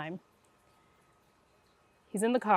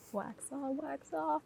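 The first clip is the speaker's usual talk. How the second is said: soft, higher mock sensei; lightly imitated martial arts teacher, much softer; Mister Miyagi; 5 semitones higher, 11 dB quieter, slower than usual. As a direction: hush it like a wise old master. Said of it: "she was like Mister Miyagi".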